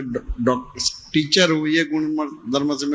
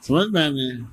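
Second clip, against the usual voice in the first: usual speaking voice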